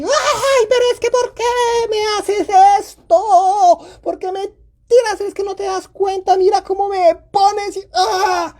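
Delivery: high-pitched exasperated wailing rant; losing it. Acted. Parent loses it at the child over spilt milk, exasperated wail. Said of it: "Entonces tú llegas y ... te sales de casillas".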